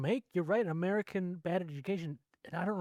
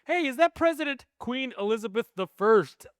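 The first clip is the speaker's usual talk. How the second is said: mock dumb question; 7 semitones higher, 6 dB louder than usual. Parodying a clueless American line.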